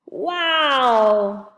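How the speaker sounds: wide-eyed gasping wow; awed camper seeing the camp; amazed. A narrator's reading. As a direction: breathe out the wonder.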